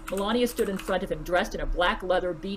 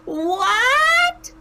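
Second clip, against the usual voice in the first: very high loud shocked "what"; shocked shriek; 7 dB louder than usual. A narrator's reading. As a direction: shriek in disbelief. Melania shrieks in disbelief.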